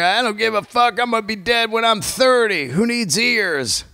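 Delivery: mock reckless youth; reckless young self. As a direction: brush it off like a cocky kid. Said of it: "you're young".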